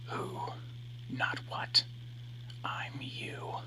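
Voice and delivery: unvoiced ghostly whisper; eerie whisper. A narrator's reading. Reflection whispers to him to win her back. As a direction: breathe it like a voice inside his head.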